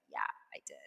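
very quiet, low whispered reply; resigned stepdad. Retold or imitated. Stepdad admitting he carried the suitcase, resigned. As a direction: imitate a resigned man under his breath. Stepdad's weary confirmation, acted out.

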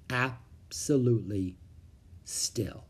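slowing, dropping to a whisper; hushed stillness. A narrator's reading. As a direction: let it die away to a hush. Everything goes still after the spin.

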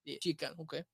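soft mock Italian accent; very Italian American accent. Shown as a demonstration. Cook confirming the ingredient, put-on Italian accent. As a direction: sign off lightly in the Italian accent. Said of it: "you're an Italian American, very Italian American".

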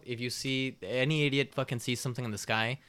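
normal talking voice

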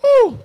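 high, loud preacher's whoop. Real, not acted. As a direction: let out a celebrating whoop.

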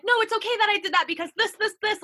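defensive excuses tumbling out; mock self-justifying. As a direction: rattle off excuses in a rush. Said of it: "being like".

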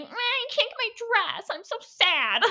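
high-pitched mock whine; hammed-up, high-voiced complaint; mock sad whining. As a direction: whine it high like a pouting bride. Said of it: "because she's like".

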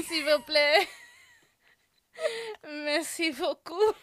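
high, loud mock French accent; hammed-up French with a laugh in it. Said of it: "This is not Emily in Paris".

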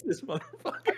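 laughing through the insult, pitch up; a fond curse, half lost in laughter. Real, not acted. Laughing at a friend who mocks his taste.

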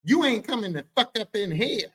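high, sassy refusal; imitated sassy objection; sassy boyfriend. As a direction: bar the door with full attitude. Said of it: "he got all sassy like this".

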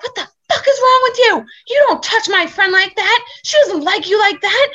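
loud, high-pitched confrontation; high, forceful scolding shout; retold angry screaming. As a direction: yell it in outrage, imitating the girl. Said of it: "starts screaming at him".

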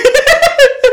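a burst of high laughter. Real, not acted. Laughing at own story.

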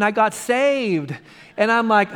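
ordinary talk, nothing marked

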